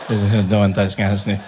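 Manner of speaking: low, slow confiding hum; confidential voice. Shown as a demonstration. Gossiping like office people, low confidential voice. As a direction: sink low and draw it out privately. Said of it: "That's a confidential voice".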